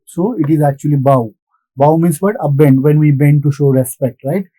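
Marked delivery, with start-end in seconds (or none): 1.0-1.3 "bow" with the ow vowel of "how"; "bow" rhyming with "how"; rhymes with how. Shown as a demonstration. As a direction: say "bow" as in bending in respect, like "how". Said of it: "rhymes with how".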